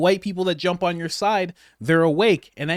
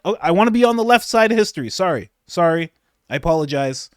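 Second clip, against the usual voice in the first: fast, louder nervous apology; hurried mock apology; flustered correction; 5 dB louder, faster than usual. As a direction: gush the correction out in a panic.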